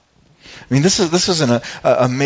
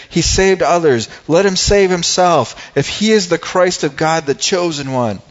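loud, high taunt, easing at the end; sneering taunt; 5 semitones higher, 5 dB louder than usual. Acted. Rulers jeering at Jesus on the cross, sneering. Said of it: "the rulers sneered at him".

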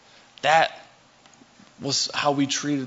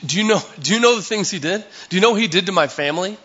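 higher, louder, fast accusing questions; angry confrontation; 5 semitones higher, 4 dB louder, faster than usual. Pretend. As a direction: confront Jesus, hurt and angry. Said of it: "a little angry".

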